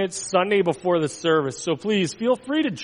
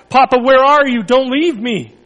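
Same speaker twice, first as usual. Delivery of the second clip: louder, higher, desperate pleading; frantic cry; 5 semitones higher, 6 dB louder than usual. Acted. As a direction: beg not to be abandoned. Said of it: "Jesus cried, frantically searching the sky".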